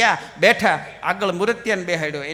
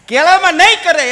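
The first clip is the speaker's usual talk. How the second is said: કેળામાં નહીં કરે much louder and higher, laughing; punchline shout; 7 semitones higher, 10 dB louder than usual. Shown as a demonstration. Comedian repeats the banana punchline louder to the crowd. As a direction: hit the punchline hard.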